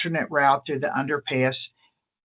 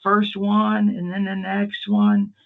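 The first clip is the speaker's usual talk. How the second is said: put-on higher, louder, slowed old-man telling; her elderly dad; 5 semitones higher, 4 dB louder, slower than usual. Retelling her dad's words at the funeral, higher and slow. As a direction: imitate the dad earnestly describing the firemen. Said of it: "and, and her dad goes".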